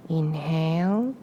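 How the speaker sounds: calm meditation voice with long pauses between phrases; guided breathing meditation. Real, not acted. Guiding listeners to inhale and exhale on a prayer, meditative. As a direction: lead the breath gently, phrase by phrase, leaving room after each.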